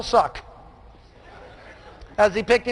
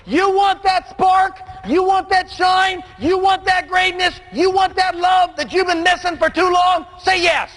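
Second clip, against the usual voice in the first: full-force preaching; 9 semitones higher than usual. Acted. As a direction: preach it to the back row, drawing each phrase out.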